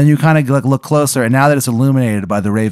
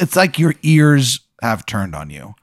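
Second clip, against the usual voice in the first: normal talking voice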